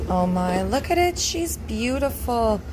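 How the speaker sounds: high, delighted, drawn-out praise; excited. Real, not acted. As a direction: gush over the finished loaf. Sees finished loaf.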